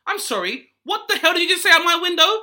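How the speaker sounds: high, loud shocked shriek; hammed-up outrage at marching protesters; shocked disbelief. Shouting at protest out window.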